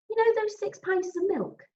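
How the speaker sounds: high, puzzled flatmate voice; puzzled Milky. Acted. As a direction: ask it high and baffled. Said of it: "sounding even more puzzled".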